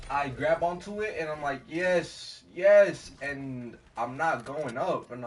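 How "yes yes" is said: high, loud acted cheers; triumphant relief. Swimmer grabbing onto the float, loud mock relief. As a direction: cry out as if finally saved.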